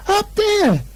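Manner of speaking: very high excited squeak; tiny pig shouting up there; high piping frightened cry; Piglet pointing. A narrator's reading. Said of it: "said Piglet".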